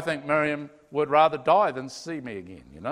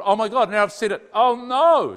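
louder, rising oh no; dismayed gasp; 6 semitones higher, 9 dB louder than usual. Retold or imitated. A wife shocked at what she said, loud dismay. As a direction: caught out by her own words.

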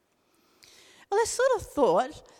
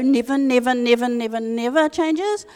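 louder repeated "never" hammered home; like Diane; 7 dB louder than usual. Pretend. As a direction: preach each "never" like Diane, building insistence. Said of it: "I could do a Diane and go".

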